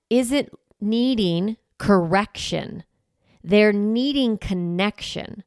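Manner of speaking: slowed, weighty words on correction and connection; slow stressed teaching point; slow emphatic contrast. Shown as a demonstration. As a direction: lean on each word, slowly.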